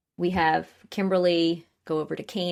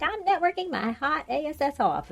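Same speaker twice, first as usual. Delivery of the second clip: much higher put-on voice, imitating Bella; mock Bella voice; 12 semitones higher than usual. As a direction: toss it off in a high mock Bella voice. Bella quoted in recap.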